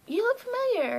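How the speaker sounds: high, loud mock recognition; surprised recognition. Acted quote of surprised recognition.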